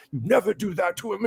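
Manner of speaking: lightly voiced old mentor laying down the rule; imitating a scolding. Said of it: "he gave me the talking to of my life".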